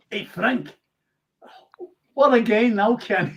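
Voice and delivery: light Scottish accent, imitating a footballer; a mild, friendly Scottish impression of the old player; Kenny Scottish accent. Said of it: "How was my Scottish accent there".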